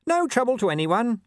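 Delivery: nervously cheerful, squeezing the words out; dog trying to bluff past surrounding wolves, strained and cheerful; strangulated cheer. A narrator's reading. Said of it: "in a strangulatedly cheerful voice".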